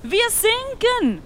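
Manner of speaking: drawn out, high and loud; panic, drag out the words; slow panicked cry. Acted. Disciples crying to Jesus that the boat sinks, slow loud panic. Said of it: "riefen sie aufgeregt".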